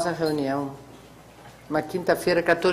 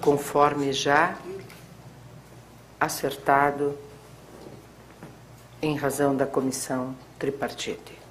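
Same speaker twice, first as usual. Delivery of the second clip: dictating; 4 dB quieter than usual. Real, not acted. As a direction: spell out the ruling for the typist. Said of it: "parágrafo".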